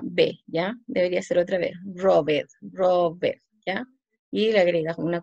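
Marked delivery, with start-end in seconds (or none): 1.8-3.4 ROBED as rob-bed, two syllables; read as spelled. Shown as a demonstration. Teacher showing students the doubled consonant, ROBED read as spelled. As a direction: sound out the written -BED in ROBED. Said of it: "no estoy pronunciando, estoy escribiendo".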